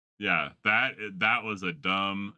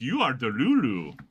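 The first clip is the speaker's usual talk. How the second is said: loud, higher African accent put-on; African accent impression; 6 semitones higher, 6 dB louder than usual. Funny reaction guy mocking the girl, African accent. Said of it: "the African accent".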